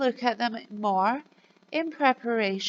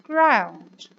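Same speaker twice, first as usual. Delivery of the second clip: model word; 6 dB louder than usual. Shown as a demonstration. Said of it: "can you say it with me".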